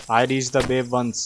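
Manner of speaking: low, slow, soft narration; hushed game-text narration; slow dramatic reading. Acted. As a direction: read it like a sad legend.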